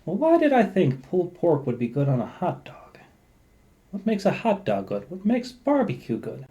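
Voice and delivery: questioning own thought. Acted. Speaker voicing someone questioning a random shower thought, lightly puzzled. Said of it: "And then you go".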